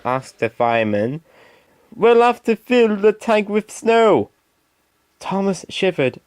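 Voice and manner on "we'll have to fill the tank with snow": driver voice. Acted. Driver calling.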